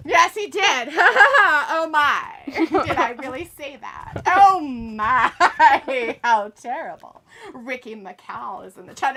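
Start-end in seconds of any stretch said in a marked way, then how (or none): 0.1-7.2 loud outburst breaking into laughter; amused shock. Real, not acted. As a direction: laugh through mock-scandalised exclamations. Cracks up at own double entendre.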